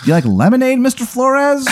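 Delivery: sly lilting question rising sharply; mock-sweet interrogator rising at the end; cop question. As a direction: voice climbing high on Flores. Cop character asks.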